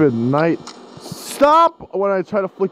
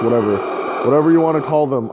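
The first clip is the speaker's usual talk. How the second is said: normal talking voice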